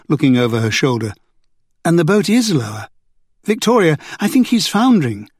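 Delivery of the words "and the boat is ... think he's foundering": rising alarm, pitched up; a touch of alarm, voice lifted. A narrator's reading. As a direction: sound the alarm as the boat sinks. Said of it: "There was alarm in her voice now".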